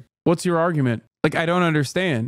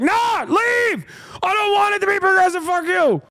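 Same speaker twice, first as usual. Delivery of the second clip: mock republican shriek. Streamer mocking a Republican chatter, shrieking caricature. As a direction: scream it like a furious caricature. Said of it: "He's like".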